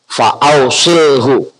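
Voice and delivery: "fa ausilhu" drawn out slowly; slow model reading. Shown as a demonstration. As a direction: stretch "fa ausilhu" syllable by syllable. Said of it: "Itu bacanya". ("fa ausilhu" dibaca perlahan dan panjang)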